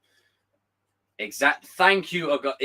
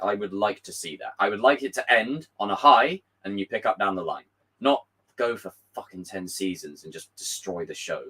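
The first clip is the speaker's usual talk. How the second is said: ordinary talk, nothing marked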